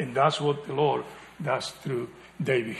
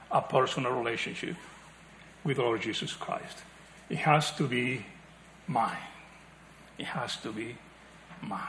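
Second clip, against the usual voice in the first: intimate softening; 4 semitones lower, 10 dB quieter than usual. Acted. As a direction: drop to a soft, personal hush. Pleading for personal faith.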